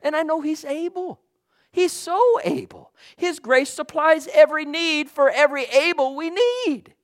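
preacher carried away by the Spirit; fiery preaching. Real, not acted. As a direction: let the fervour run away. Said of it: "I gotta talk like that sometimes".

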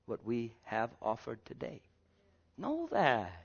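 normal talking voice

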